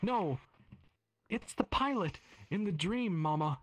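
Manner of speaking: put-on high, pleading child voice; little girl voice. Daughter voiced in story.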